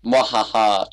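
hammy evil cackle; theatrical villain mwahaha; mad scientist laugh. Acted. Evil laugh reading sign.

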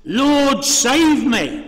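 a sinking man calling out; mock shout. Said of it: "he shouted to Jesus".

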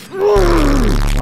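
long, loud, high scream; startled scream. Real, not acted. Player caught by the enemy, screaming. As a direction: scream out as it hits. Enemy strikes after the warning.

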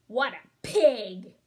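slow, loud indignant scoff. Acted. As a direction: spit out the insult slowly. Indignant wolf exclamation.

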